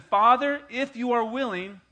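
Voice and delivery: high, loud pleading prayer; Jesus pleading in Gethsemane, loud and high; Jesus praying. Acted. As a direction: plead up to the Father. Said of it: "He said".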